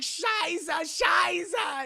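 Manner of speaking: high, loud cursed Scheiße; German cursing. Retold or imitated. Colonel cursing in German as they take off, high and loud. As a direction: spit out the Scheiße in frustration.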